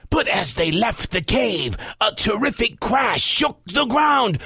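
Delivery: usual speaking voice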